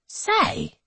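high, breathy call to the room; the host hails his guests; hearty host. A narrator's reading. Hancock addresses guests.

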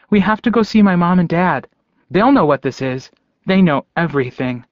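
lightly voiced excited child, starting high; a hint of a young boy insisting; eager little boy. A narrator's reading. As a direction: urge them along like an eager kid. Remembered little boy talking eagerly.

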